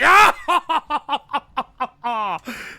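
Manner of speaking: long laugh starting high and loud; burst of laughter. Real, not acted. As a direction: burst out laughing, then let it run down. Bursts out laughing at solved answer.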